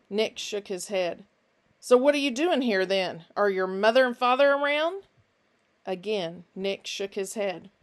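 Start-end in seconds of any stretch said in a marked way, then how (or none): 1.8-5.0 louder, smooth questioning; fake-friendly Finch. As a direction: repeat the name and pry, high and insistent. Finch coaxes Nick.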